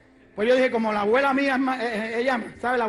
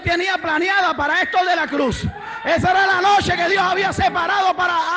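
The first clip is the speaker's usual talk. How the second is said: fiery preaching; 4 semitones higher than usual. Acted. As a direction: preach it out to the congregation. Preaching at full force.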